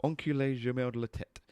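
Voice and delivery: "Enculé gemelle de la tête" sounded out in French; tentative French pronunciation. Shown as a demonstration. Streamer checking French pronunciation with Lily, careful attempt at "Enculé gemelle de la tête". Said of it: "am I pronouncing this correctly".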